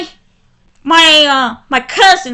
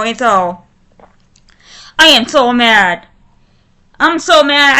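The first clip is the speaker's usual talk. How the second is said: ordinary talk, nothing marked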